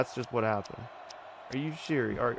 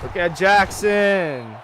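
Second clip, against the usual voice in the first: loud, slow, high excited call; excited cheer; 6 semitones higher, 9 dB louder, much slower than usual. Real, not acted. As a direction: cheer the runner on. Excited by a big play.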